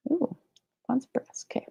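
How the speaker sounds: soft whispered title. Real, not acted. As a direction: breathe it out in a whisper.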